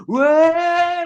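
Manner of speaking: high, drawn-out "whoa" whoop; imitated rowdy whoop. Bandmate imitating lads banging about in the van, rowdy whoop. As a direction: mimic the lads whooping away.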